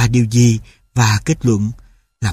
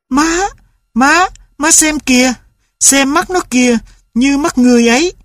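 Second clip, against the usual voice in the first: put-on high eager boy voice; a boy begging mother to look; excited child; 12 semitones higher than usual. A narrator's reading. Tulip shows his mother the dog's eyes, high and excited.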